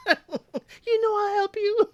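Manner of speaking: very high, slow mock mother voice; hammed-up Mama. Mama insisting to Bada, high slow hammed-up voice. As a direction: stretch it out high like a doting mama. Host repeats Mama's line.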